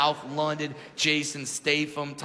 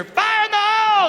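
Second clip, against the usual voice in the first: high, loud military shout; shouted command; 8 dB louder than usual. Retold or imitated. Soldier shouting before firing the machine gun. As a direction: yell it like a gunner's warning.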